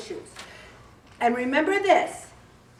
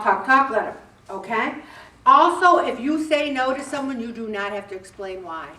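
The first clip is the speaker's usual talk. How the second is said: usual speaking voice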